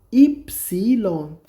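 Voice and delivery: Epsilon very slowly, as "ip-siii-lon"; syllable-by-syllable demonstration. Shown as a demonstration. Teacher repeats name of letter Y, slow syllable-by-syllable demonstration. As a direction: break epsilon into stretched syllables.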